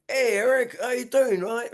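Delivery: high-pitched, hammed-up English greeting; mock English accent. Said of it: "I don't have an English accent".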